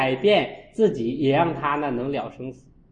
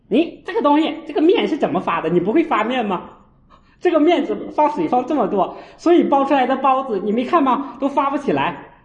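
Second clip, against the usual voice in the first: high, loud scolding of a mother; exasperated nagging at mom; 9 semitones higher, 4 dB louder than usual. Retold or imitated. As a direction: scold her sharply over the dough. Monk scolding mother over dough.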